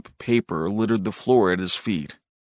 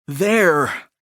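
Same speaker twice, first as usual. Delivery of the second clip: higher, drawn-out insistent word; strained, anxious; 6 semitones higher, 4 dB louder than usual. A narrator's reading. Brother insisting to his brother, strained and anxious. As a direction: impatience turning to anxiety. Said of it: "matured instead into a strained note of anxiety".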